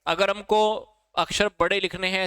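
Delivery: ordinary delivery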